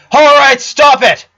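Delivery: high, loud scream to stop the fight; big sister screaming over the noise. A narrator's reading. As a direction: yell to break up the sisters. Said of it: "screamed Lori".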